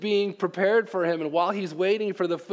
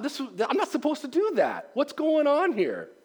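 higher, puzzled self-talk; baffled inner voice; 7 semitones higher than usual. Retold or imitated. Preacher voicing Peter's confused thoughts, higher and puzzled. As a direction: sound lost and confused at a strange command. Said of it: "you thought".

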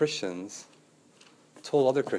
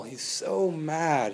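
ordinary delivery

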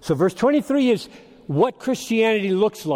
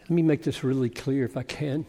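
ordinary talk, nothing marked

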